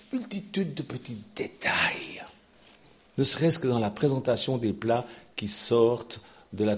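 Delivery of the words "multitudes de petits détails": whispered aside; dramatic whisper. Acted. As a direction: drop to a secret whisper. Sudden whisper for effect. (chuchoté pour l'effet)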